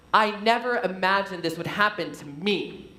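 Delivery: "happen to me" with the final "me" set apart and weighted; stress on ME. Shown as a demonstration. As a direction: lean on the last word "me". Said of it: "Maybe to other people, but not to me".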